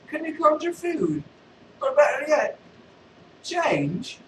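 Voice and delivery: high cheeky beggar voice; street beggar. Acted. Beggar asks Vader for food.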